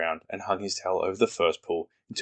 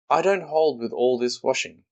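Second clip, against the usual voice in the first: mild grumble, lightly voiced donkey; a touch of sulky old Eeyore complaining; understated grumbling donkey; 5 semitones higher, 4 dB louder than usual. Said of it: "grumbled Eeyore".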